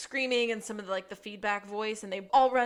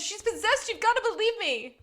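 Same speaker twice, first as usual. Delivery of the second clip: much higher, mock desperate plea; desperate Alice; 11 semitones higher, 7 dB louder than usual. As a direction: beg them to believe, hammed-up. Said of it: "She says".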